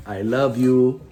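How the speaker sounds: ordinary delivery, nothing marked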